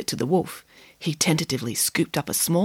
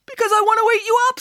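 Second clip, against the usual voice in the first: wolf voice; 13 semitones higher, 4 dB louder, faster than usual. Acted. Wolf confesses.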